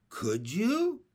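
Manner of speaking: Henry asking the doctor hopefully; eager question. Acted. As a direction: ask it keenly. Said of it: "asked Henry eagerly".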